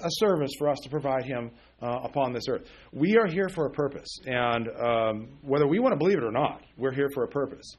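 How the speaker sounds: ordinary talk, nothing marked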